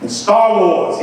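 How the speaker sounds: usual speaking voice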